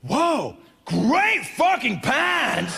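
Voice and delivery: slow, drawn-out, high admiration; emphatic friendliness. Acted. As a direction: gush over them, stretching every word. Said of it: "it emphasizes my friendliness".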